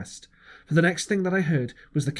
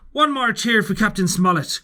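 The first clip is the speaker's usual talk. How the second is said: loud, high rallying cry; Long John leading the crew in a loud, raised cheer; cried out; 7 semitones higher, 6 dB louder than usual. A narrator's reading. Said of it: "cried Long John".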